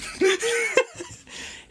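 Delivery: high rising laughter. Real, not acted. Host laughing about the exile, high laugh. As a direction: let the laugh bubble up.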